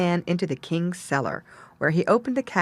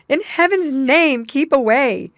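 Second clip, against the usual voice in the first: loud, slower desperate plea; pleading cry; 6 semitones higher, 6 dB louder than usual. A narrator's reading. Sisters pleading with their sister to keep away. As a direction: beg her to stay back. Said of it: "screamed the other two".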